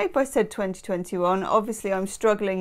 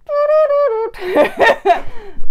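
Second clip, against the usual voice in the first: delighted high laugh; 7 dB louder than usual. Real, not acted. Card matches the crows.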